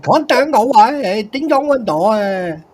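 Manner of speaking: exaggerated mimic of American card talk; mock American accent. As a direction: parody how the Americans sound. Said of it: "I haven't got a fucking clue what you guys were just saying".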